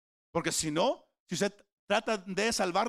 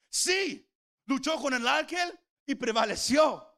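emphatic shout; 6 semitones higher, 4 dB louder than usual. Real, not acted. Preacher exclaims Jacob's victory.

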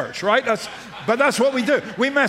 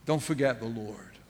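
hushed plea; 9 semitones lower, 9 dB quieter than usual. Acted. Repeated solemn plea.

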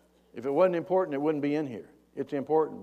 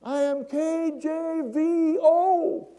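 high, loud, slow stretched holler; acted long-distance yell; 8 dB louder, much slower than usual. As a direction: shout each letter drawn out to someone far below. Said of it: "the guy yells down".

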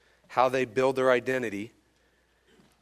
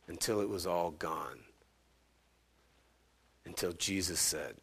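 grave hush with long pauses; hushed reverence; 5 semitones lower, 10 dB quieter than usual. Real, not acted. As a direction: let it sink almost to a whisper. Solemn telling of the crucifixion.

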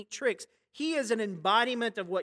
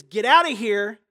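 loud, commanding rebuke; stern rebuke; 10 dB louder than usual. Acted. Jesus rebukes the demon, loud stern command. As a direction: order the demon out with authority. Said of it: "And he rebuked him".

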